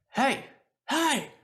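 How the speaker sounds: high-pitched acted shout for attention; calling out. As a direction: call after someone running off. Character calls to fleeing enemy.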